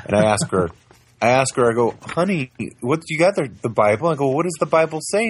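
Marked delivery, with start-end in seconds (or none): 2.0-4.1 put-on higher, singsong asking a toddler; sweet parent voice. Father quoting himself asking his two-year-old, in a higher, coaxing voice. 4.3-5.3 put-on higher, singsong asking a toddler; sweet parent voice. Father quoting himself asking his two-year-old, in a higher, coaxing voice.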